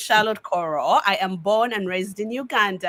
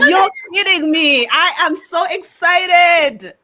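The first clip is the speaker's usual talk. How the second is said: higher and louder, slow thrilled exclamation; excited; 9 semitones higher, 8 dB louder, slower than usual. Real, not acted. Guest overjoyed that the hosts are visiting California. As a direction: stretch out the delight.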